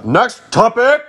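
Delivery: high, stretched-out announcement; calling out. Real, not acted. Podcast host moving the talk along, calling out. Loudly cutting off the last topic.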